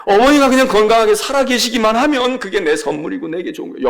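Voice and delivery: normal talking voice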